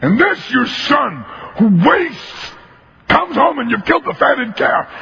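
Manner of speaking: high, near-crying complaint; tearful bitter tirade. Acted. Older son accuses father, high, on the edge of tears. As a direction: let the grievance crack into tears. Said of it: "the tirade this guy goes into".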